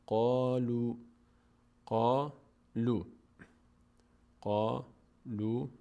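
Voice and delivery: slow قالوا as qaa-luu; measured recitation of قالوا, ending on uu; silent final alef. Shown as a demonstration. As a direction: stretch both long vowels of قالوا. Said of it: "این الف خونده نمیشه".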